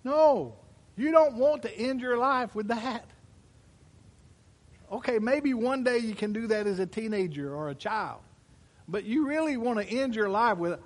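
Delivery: loud, high, driving sermon voice; full-force preaching. Acted. Pastor preaching hard to his congregation, loud and high. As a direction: preach it out to the whole room.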